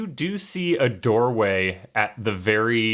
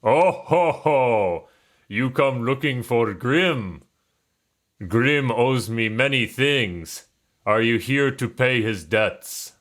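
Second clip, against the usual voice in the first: put-on Russian accent; 3 semitones higher than usual. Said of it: "I don't know why I went Russian".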